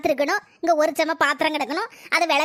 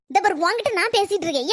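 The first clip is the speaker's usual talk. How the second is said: normal talking voice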